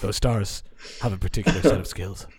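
low Neeson menace; a hint of the Taken threat, low and level; Liam Neeson impression. Friend mashes Mufasa into the Taken speech, low Neeson voice. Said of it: "I can only do him on that Taken phone call".

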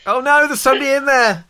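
high, loud shocked squeal; mock panic. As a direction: caught on the loo, shriek in alarm. Cyber controller caught on toilet.

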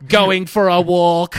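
laughing through a Dalek voice. Pretend. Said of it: "I sound like a Dalek".

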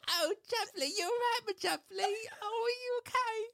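very high, squeaky cockney fussing; mock cockney accent. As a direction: coo over a pet in exaggerated cockney. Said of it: "That accent is something".

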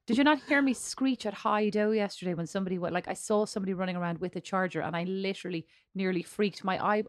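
ordinary talk

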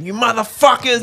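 shouted high curse at the pranksters; furious neighbour shouting at giggling kids; mock yell. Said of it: "you caught out giggling going".

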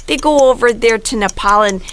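put-on light Minnesota Fargo accent, slowed in places; put-on Fargo Midwest accent. Host telling the plot for the game in a Fargo accent. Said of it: "that was a solid accent right there".

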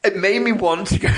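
ordinary talk, nothing marked

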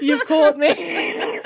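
laughing slowly. Real, not acted. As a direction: draw it out through laughter. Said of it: "Oh no".

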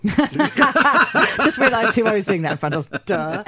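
slow, embarrassed laughing. Real, not acted. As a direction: laugh it out sheepishly. Embarrassed after Welsh joke.

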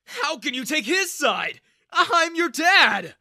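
father shouting at his daughter who sides with another; hurt shout. A narrator's reading. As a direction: plead and yell at once.

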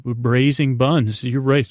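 normal talking voice

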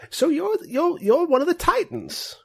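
high-pitched, friendly grandpa voice; friendly old man. Acted. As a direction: play a kindly old man, pitched well up. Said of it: "he's like an old man".

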